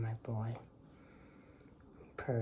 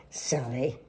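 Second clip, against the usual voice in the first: ordinary delivery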